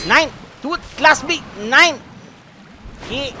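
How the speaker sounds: high, loud panicked cry; panicked shout. Real, not acted. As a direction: shriek at the attacker. Attacked by slime.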